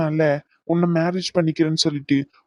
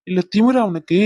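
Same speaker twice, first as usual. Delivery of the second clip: normal talking voice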